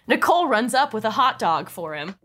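ordinary delivery

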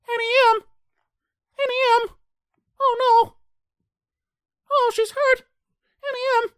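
very high, loud falsetto cries; Dorothy impression. Dorothy calling for Annie M, mock falsetto cries. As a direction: cry out in a hammed-up girlish falsetto. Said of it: "That's exactly how she sounds".

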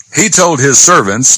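normal talking voice

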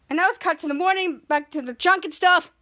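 usual speaking voice

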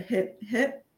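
ordinary talk

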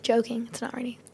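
playful whispered aside. Real, not acted. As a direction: whisper it like a joke.

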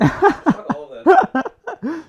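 laughing loud curse. Real, not acted. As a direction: blurt it out laughing. Laughing rant at channels.

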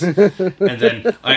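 words squeezed out through laughter, slow and high; laughing. Real, not acted. Telling co-host about his sign, laughing. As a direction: laugh while you talk.

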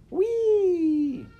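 very high, loud whee of joy; gleeful squeal. A narrator's reading. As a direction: squeal it out with delight. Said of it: "she cried".

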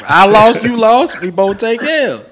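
usual speaking voice

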